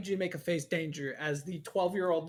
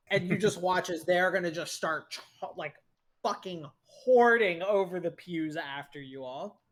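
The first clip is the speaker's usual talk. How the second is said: ordinary talk, nothing marked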